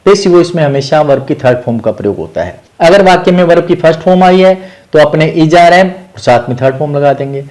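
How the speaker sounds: usual speaking voice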